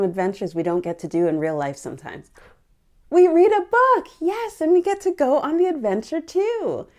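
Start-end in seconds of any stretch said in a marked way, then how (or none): 3.1-5.7 eager answer. Real, not acted. Eager answer to her question.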